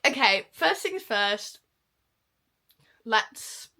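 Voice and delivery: normal talking voice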